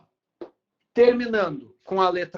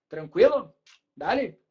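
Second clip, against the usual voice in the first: ordinary talk, nothing marked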